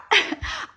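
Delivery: a quick airless laugh; breathy laugh. Real, not acted. Laughing at her dog freaking out, short breathy laugh.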